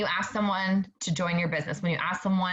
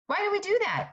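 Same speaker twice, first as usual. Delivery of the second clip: much higher, exasperated repeat of the question; pitched-up incredulous echo; emphatic repeat; 11 semitones higher than usual. Real, not acted. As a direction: fling the question up high in disbelief. Repeats question, second more emphatic.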